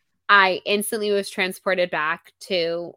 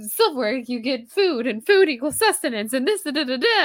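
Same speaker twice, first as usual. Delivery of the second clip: high, loud runaway ramble; spiralling; 7 semitones higher, 6 dB louder than usual. Acted. Friend spirals over buying silverware, spiralling. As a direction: let the train of thought spiral upward, loudly. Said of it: "she just officially went to".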